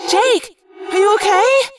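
high, loud, anxious call; worried cry. Acted. Scene: Cassie calling to Jake after the hit, anxious cry. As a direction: call out to him in alarm. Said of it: "Cassie cried".

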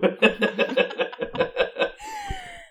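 very high, breathy giggle; high squeaky laugh. Real, not acted. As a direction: giggle helplessly. Laughing at his own deflection.

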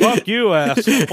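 high, playful fake outrage; mock angry insult. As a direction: snap it out as a joke.

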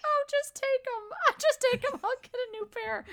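embarrassed friend. Retold or imitated. Embarrassed friend giving up her tweezers to security, high flustered squeak. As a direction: squeak it out, mortified. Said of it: "She was like".

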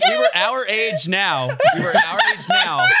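high squeaky laughter through the words; can barely get it out for laughing; giggling helplessly. Real, not acted. Laughing while retelling dream.

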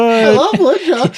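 high, slow, gushing squeal; excited squeal. Acted. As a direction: squeal it out with delight. Excited squeal about woodchucks.

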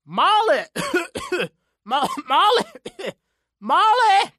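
very high, loud frantic calls broken by coughing; screaming through coughs. A narrator's reading. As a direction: choke on smoke while screaming Molly. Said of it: "He called her name again and again".

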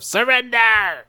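high, drawn-out mock yell; long high cry of a tiny villain; catchphrase yell. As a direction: belt it out like a cartoon catchphrase. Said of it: "yells his catchphrase of".

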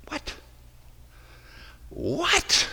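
high, startled disbelief; a sharp, high double take; stunned what. Real, not acted. Preacher reacting to the verse in stunned disbelief.

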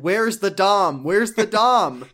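loud, high, drawn-out plea; hammed-up frantic search; mock desperate demand. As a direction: call out slowly to a whole forum. Acts out frantic forum pleas.

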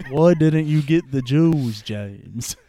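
hammed-up Liverpool accent, starting loud. M berating Bond, mock Liverpool accent. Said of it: "M is played by, like, [name]".

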